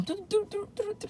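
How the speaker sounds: high, soft rapid doo-doo thumps; imitated heartbeat. Nervous patient on the table, imitating her racing heart. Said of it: "my heart is going".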